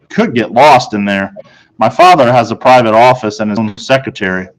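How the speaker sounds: plain reading voice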